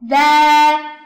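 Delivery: 呗 held long and loud; long open syllable. Shown as a demonstration. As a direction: draw out the open vowel in 呗. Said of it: "长音阶开口".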